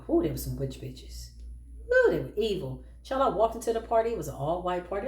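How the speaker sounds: normal talking voice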